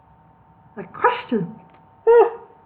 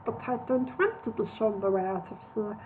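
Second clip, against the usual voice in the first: sulky mutter; 9 dB quieter than usual. A narrator's reading. Solomon sulking.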